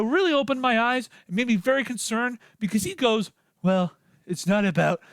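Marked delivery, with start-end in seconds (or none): 3.6-5.0 low gravelly coach voice, much deeper; JB Bickerstaff voice. Retold or imitated. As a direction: drop the voice down into a gravelly growl. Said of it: "let me get my J B Bickerstaff gravelly voice".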